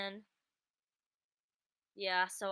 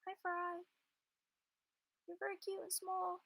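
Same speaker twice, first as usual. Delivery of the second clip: much higher cooing voice; 13 semitones higher than usual. Real, not acted. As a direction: coo at a tiny kitten. Cooing at her sleeping cat.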